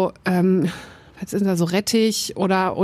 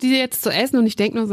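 ordinary delivery

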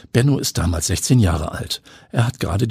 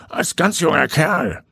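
put-on old man voice; 9 semitones higher than usual. A narrator's reading. Old neighbour confides, voiced.

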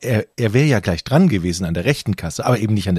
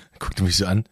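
ordinary talk, nothing marked

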